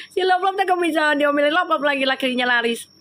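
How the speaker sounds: ordinary talk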